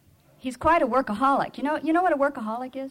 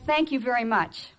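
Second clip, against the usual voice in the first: ordinary delivery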